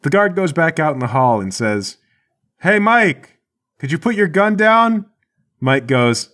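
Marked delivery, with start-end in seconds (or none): 2.6-5.1 high, raised voice down a hallway; calling out. Acted. Captured guard asking Mike to disarm, calling out high. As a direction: holler a coaxing request to your partner. Guard calls to partner in hall.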